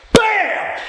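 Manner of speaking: a high shouted bam; excited bam. Retold or imitated. To the host, bragging on his son's tackle, high excited shout. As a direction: shout it like the hit lands. Describing son's big hit.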